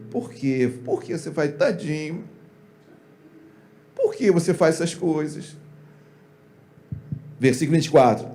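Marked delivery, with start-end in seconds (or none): 0.1-5.6 mock-gentle pleading. Imitating Eli coddling his sons, mock-gentle pleading. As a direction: plead mildly, as if pitying the poor thing. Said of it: "Ou você falaria assim, como ele falou".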